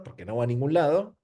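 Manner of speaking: ordinary talk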